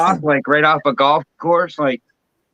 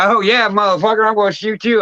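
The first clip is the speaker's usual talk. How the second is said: mock cruel hunter; 6 semitones higher than usual.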